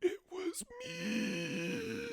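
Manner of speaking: high, slow anguished cry; wailing confession. Acted. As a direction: wail out the confession. Dramatic confession.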